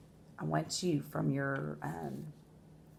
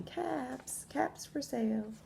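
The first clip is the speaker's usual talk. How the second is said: calling street seller; 9 semitones higher, slower than usual. A narrator's reading. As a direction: call out wares from afar, lightly. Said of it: "back to town calling".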